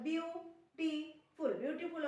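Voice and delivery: Beautiful drawn out slowly, syllable by syllable; slow demonstration. Shown as a demonstration. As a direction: stretch out beautiful for learners to copy. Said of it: "beautiful (repeated slowly)".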